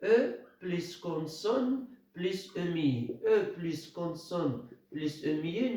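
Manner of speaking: slow, deliberate rule recitation; slowly spelled e plus consonne plus emi; spelling out the rule. Shown as a demonstration. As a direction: lay the rule out slowly for the class. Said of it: "la règle".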